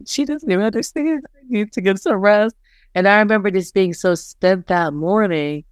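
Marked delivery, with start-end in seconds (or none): 0.1-2.5 mildly higher, half-tearful retelling; a touch of remembered tears, not full crying; retold tearful plea. Coach recalling exhausted night, mild tearful voice. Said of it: "I remember crying and being like".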